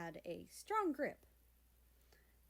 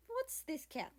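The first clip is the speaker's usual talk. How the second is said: put-on higher, lightly Russian-accented question; 8 semitones higher than usual. Russia peering into the crate, light Russian accent. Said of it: "spoke with a heavy Russian accent".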